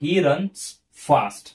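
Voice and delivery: teacher repeating the adverb example for the class; slow example sentence. Shown as a demonstration. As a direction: read it out slowly as a model. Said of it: "बघा".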